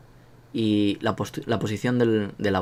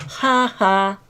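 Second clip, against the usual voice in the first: mock Nelson laugh; 9 semitones higher than usual. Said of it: "Como Nelson cuando decía".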